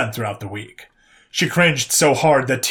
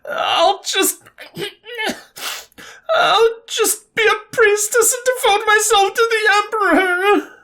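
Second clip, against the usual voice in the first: high, crying half-yell; a tearful outburst into her arms; half-yelled despair; slower than usual. A narrator's reading. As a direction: wail it into your arms, half laughing, half crying. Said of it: "she half yelled into her arms".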